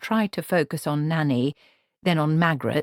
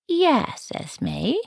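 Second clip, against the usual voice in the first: innocently; 7 semitones higher than usual. A narrator's reading. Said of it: "said Nanny Ogg innocently".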